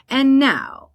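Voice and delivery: high, loud, slow stadium announcer voice; dramatic announcer. Retold or imitated. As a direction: announce the band with extra drama. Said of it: "I put such inflection in my voice".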